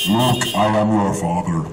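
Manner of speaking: deep, slow villain line on heavy gas; Darth Vader. Pretend. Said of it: "I just have to do it that way".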